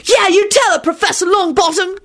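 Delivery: very high heckling cry; worked-up, high-pitched cheer; angry holler. A narrator's reading. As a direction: holler encouragement across the table. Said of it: "Timothy hollered out angrily".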